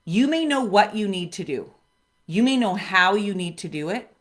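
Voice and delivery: ordinary talk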